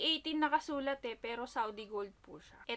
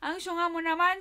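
louder, higher laughing jab; laughing tease; 5 semitones higher, 8 dB louder than usual. Retold or imitated. Teasing her sister, laughing louder. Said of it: "tinawanan ko siya".

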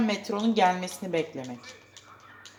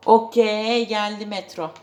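excited; 4 semitones higher, 10 dB louder, slower than usual. Real, not acted. Metro finally arrives.

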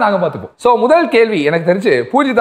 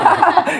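laughing; 12 semitones higher than usual. Real, not acted. Host laughs at guest's fear.

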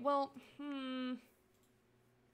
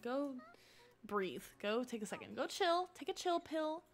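ordinary talk

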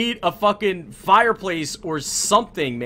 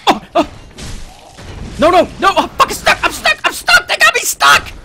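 high, loud panicked yelling; panicked shouting; 8 dB louder than usual. Real, not acted. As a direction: shriek in real panic, trapped and helpless. Panicking, stuck during the game.

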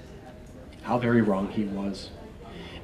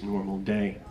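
ordinary delivery, nothing marked

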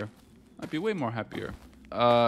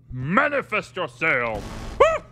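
mock summoning; 5 semitones higher, 3 dB louder, much slower than usual. Summoning the ghost.

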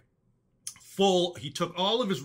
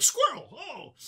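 mock sudden distraction, pitched way up; forgetful, distracted old-timer; 9 semitones higher, slower than usual. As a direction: imitate losing the thread mid-sentence. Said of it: "he gets a little forgetful".

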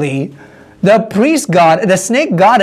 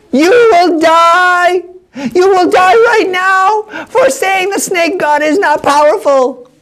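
high, slow, otherworldly chant; eerie priestess voice; 11 semitones higher, much slower than usual. Acted. Snake priestess threatening Paul, high and slow. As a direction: drag each word out like a possessed priestess. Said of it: "she was screeching".

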